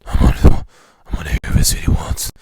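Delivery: loud, raspy gravel Batman growl. Retold or imitated. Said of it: "that's kind of serious to it".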